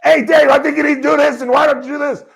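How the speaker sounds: yelling at the TV like a frustrated fan; mock yelling at the TV. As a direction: bark coaching tips at the screen. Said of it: "it's not like I'm screaming at the television".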